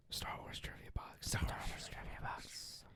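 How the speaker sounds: hushed whispered show title; unvoiced-breath whisper, very soft. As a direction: whisper the name like a spooky echo. Recurring whispered show jingle.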